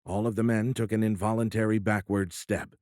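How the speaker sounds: plain reading voice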